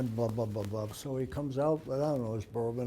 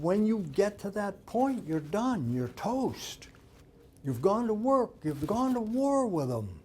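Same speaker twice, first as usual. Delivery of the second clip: raised, punchy retold warning; emphatic warning; 7 semitones higher, 3 dB louder than usual. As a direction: drive each point home, pitched up. Trainer warns husband in retold quarrel.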